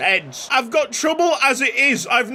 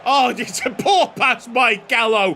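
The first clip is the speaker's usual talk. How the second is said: normal talking voice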